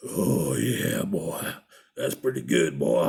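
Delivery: very deep, drawn-out biker moan; mock burly biker's low moan of enjoyment; imitated pleasured biker. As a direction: sink far down and relish it like the biker. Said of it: "it's like".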